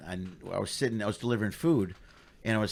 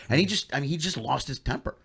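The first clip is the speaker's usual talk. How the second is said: ordinary delivery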